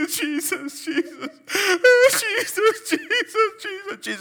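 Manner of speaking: high wailing fake sobs calling Jesus; mock wailing sob. Preacher mocking a woman sobbing in bed at night, high wailing. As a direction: ham up a weeping woman crying out.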